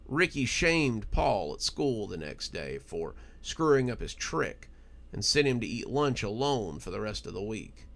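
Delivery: usual speaking voice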